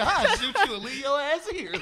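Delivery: mock the old man's threat while cracking up; laughing mock threat. Retelling the old man's threat to friends, laughing loud.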